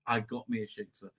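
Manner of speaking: low, soft mock aside; imitated quiet gloating. Host imitating Spielberg pleased with his catch, quietly smug. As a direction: murmur it like a private boast. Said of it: "he's just, like, saying".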